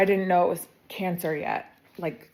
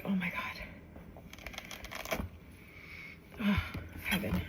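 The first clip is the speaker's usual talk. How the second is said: much softer, slow breathy delight; awed whisper; 13 dB quieter, much slower than usual. Real, not acted. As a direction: whisper it as if savouring a smell. Smelling her cooking.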